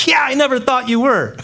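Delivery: lightly hammed-up sarcasm; sarcastic retort. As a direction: tease them, playfully dismissive. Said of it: "and go".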